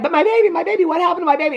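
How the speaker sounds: high-pitched retold panic; mock-frantic, voice lifted high; frantic mother. Mother asking after her baby, mock panic.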